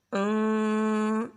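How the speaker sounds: slow long nasal hum, only partly through the nose; drawn-out hidden ن, light nasal hum; light ikhfa hum. Shown as a demonstration. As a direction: correct ikhfa sound. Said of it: "اس طرح پڑھیں گے".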